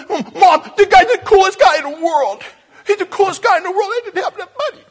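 put-on a thrilled little boy bursting with news; excited seven-year-old. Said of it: "and I went".

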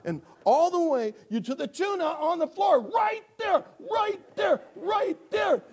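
high, loud, exasperated yell; hammed-up desperate cat owner; frantic shouting. Acts frantic owner showing the cat.

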